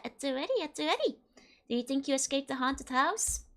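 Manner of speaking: ordinary delivery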